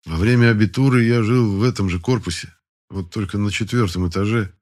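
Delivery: ordinary delivery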